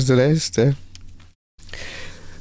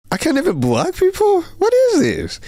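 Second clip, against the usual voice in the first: incredulous surprise. Real, not acted. Blocking a troll fails.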